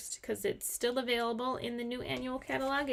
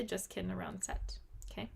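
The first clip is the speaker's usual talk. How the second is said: breathy stage whisper; 4 semitones lower, 8 dB quieter than usual. Shown as a demonstration. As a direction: whisper it like a secret aside. Repeats line in a whisper.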